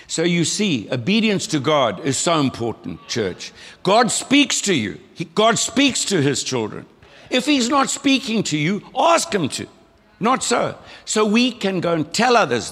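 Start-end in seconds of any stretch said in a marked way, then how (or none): 3.8-6.9 slow, higher, louder, leaning on speaks; preaching emphasis. Real, not acted. Preacher emphasising key message. 7.3-12.7 much higher, louder preacher's call; rousing exhortation. Real, not acted.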